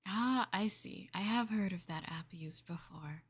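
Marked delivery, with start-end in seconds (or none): none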